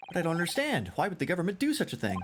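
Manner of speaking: high-pitched, puzzled protest; bewildered young defendant. Acted. Defendant questioning why the government would interfere, bewildered.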